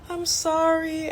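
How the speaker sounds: fake-sweet sorry, slowed down; mock apology. Coworker's fake apology, then laughs.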